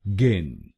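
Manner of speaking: गिन said as "gin" with a hard g; गिन said clearly as one syllable; syllable demo. Shown as a demonstration. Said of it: "हम बोलेंगे गिन".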